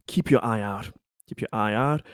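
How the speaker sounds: usual speaking voice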